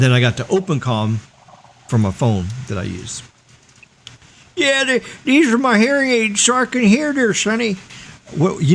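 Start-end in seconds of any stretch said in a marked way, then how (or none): 4.6-7.8 put-on high, quavery old man. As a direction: play a doddering old geezer. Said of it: "I need a old, old man sound".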